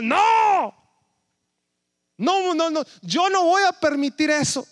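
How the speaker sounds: grito agudo de protesta, mucho más alto; no, no, no, como quien se niega desesperado; Pedro gritando. Acted. (Pedro le grita a Jesús que no lo permitirá, grito agudo)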